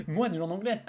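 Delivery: laughing through the words. Real, not acted. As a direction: say it while laughing. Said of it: "Ça fait longtemps qu'on l'avait pas fait".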